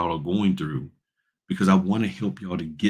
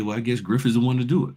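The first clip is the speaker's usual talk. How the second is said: ordinary delivery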